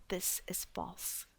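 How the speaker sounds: a slow, soft, breathless whisper; hushed denial. Acted. The memoir's author to her readers, quietly whispered. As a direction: drop to a hush and let it land. Denying the people's charge of monstrousness.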